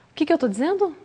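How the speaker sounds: ordinary talk, nothing marked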